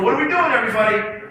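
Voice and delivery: high, loud mock alarm; freaking out. Speaker voicing students' hearts, in mock panic. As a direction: wail it as a panicked question. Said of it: "everyone's freaking out inside the brains".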